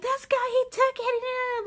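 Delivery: put-on very high, slow shrieking girls; screaming girls. Girls on the bus screaming at the driver, shrill mock scream. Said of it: "like, just screaming".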